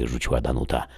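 ordinary delivery

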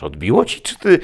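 loud, high indignant cry; telling Odysseus he has lost his mind, loud and high; outraged. A narrator's reading. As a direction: shout in disbelief.

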